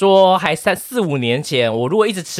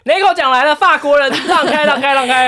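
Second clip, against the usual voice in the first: mock herald call; 11 semitones higher, 5 dB louder than usual. Mock herald clearing French shop.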